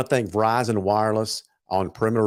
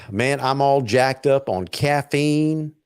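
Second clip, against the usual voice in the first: ordinary delivery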